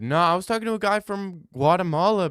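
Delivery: normal talking voice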